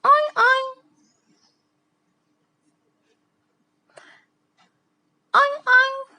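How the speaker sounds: high squeaky oink; pig oink. Pretend. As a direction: oink like a cartoon piglet. Teacher imitating pig grunt.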